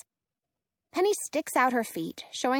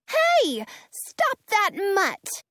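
high, loud cry at a dog; 9 semitones higher, 4 dB louder, much slower than usual. Acted. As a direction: yell after the runaway dog. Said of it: "Penny cries to Prince".

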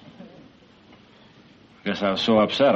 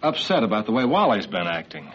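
lower, subdued apology; gentle apology; 4 semitones lower than usual. Acted. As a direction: apologise tenderly to your young son. Father apologises to young son.

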